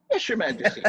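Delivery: nervous laugh. Acted.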